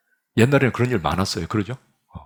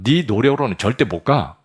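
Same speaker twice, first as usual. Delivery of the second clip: a touch louder, firm and commanding; preacher voicing God, firmly and a little louder; God's voice; 4 semitones higher, 5 dB louder than usual. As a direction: speak as God laying down the truth. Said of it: "하나님은 뭐라고 하냐면".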